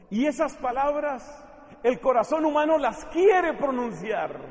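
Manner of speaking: loud, high, pleading sermon delivery; impassioned preaching. Acted. Preacher pleading with the congregation about love, full force.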